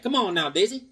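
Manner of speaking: playful cowboy character voice; cowboy to horse. Acted. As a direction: put on a Western hero urging his mount. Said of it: "He's like".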